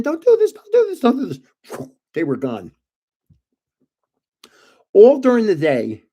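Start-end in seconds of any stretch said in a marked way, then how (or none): 0.0-1.4 high, frantic mock scream; shrieky, rapid repeated plea, much higher and louder. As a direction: imitate a panicked ex pleading at the top of her voice. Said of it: "And she's screaming".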